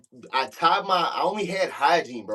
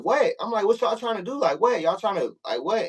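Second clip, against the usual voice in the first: retold, raised-pitch defiant "what?"; replaying his own pitched-up challenge to the crew; 7 semitones higher than usual. Retold jail cell confrontation.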